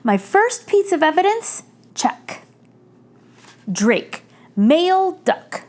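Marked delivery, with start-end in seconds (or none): none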